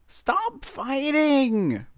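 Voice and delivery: high, long drawn-out yell. A narrator's reading. Pinkie yelling at bickering friends, high and drawn out. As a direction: cry out to stop the quarrel. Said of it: "Pinkie Pie yelled at the pair".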